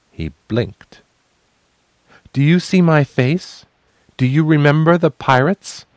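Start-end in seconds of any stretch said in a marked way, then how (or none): 2.3-5.8 high, loud challenge; defiant challenge. Acted. Facing down the sailors, a high loud defiant challenge. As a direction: throw the questions at them. Defying the crew, staking her claim.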